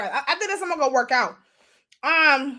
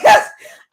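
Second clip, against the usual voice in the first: breathless laugh; 13 semitones higher, 12 dB louder than usual. Real, not acted. Laughing at her own breathlessness.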